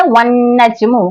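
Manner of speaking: वन नजमु slowly with the doubled न held; slow recitation demo. Shown as a demonstration. Teacher answering own question, reciting slowly. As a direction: draw out वन नजमु, stress the shadda. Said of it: "नून पर शद्दा आकर ये क्या हो गया?".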